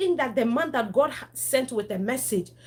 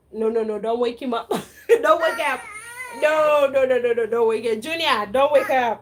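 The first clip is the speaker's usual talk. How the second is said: louder, laughing, begging him to stop; laughing, pleading; 2 semitones higher, 8 dB louder than usual. Real, not acted. Stopping child waking sleeping sister.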